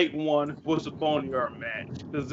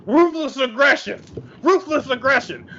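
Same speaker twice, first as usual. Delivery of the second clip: higher-pitched, punchy catchphrase imitation; raised-pitch mock battle cry; wrestler's catchphrase; 8 semitones higher than usual. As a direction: mimic a wrestler shouting his slogan, without full volume. Mimics John Cena's catchphrase.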